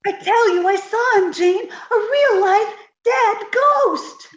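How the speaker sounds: high, pleading and frightened; scared voice on the edge of tears; terrified. Acted. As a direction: beg Jane in panic. Irma frightened by the ghost.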